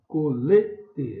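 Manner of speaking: "Colete" spoken slowly, leaning on the middle LE; teacher's slow demo of "Colete", LE stressed; stressed penultimate syllable. Shown as a demonstration. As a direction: say co-LE-te for learners, "Colete". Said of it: "é o lê".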